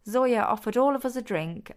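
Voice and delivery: ordinary delivery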